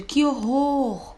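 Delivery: high, slow exclamation of horror. Shown as a demonstration. Teacher demonstrating an exclamation, high and drawn out. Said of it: "Aqui nós vimos exemplos de".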